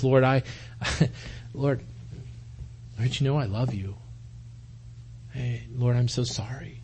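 low, very soft apology with halting pauses; hushed, choked-up plea, acted with restraint; tearful Peter. Peter answering Jesus's third question, quietly tearful. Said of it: "Peter maybe had some tears coming down".

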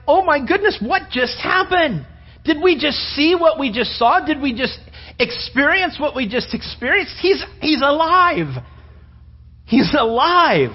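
high, breathless astonishment; stunned disbelieving joy; amazed disciples. Acted. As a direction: gasp it out like you can't believe it. Disciples amazed Jesus appeared.

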